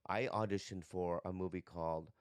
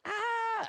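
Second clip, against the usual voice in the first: loud, very high "Ah!" scream; a plain American scream while running in circles; 5 dB louder than usual. Retold or imitated. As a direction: belt out a frightened movie scream. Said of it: "But I scream".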